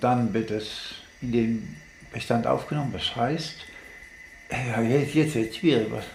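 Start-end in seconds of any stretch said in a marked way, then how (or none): none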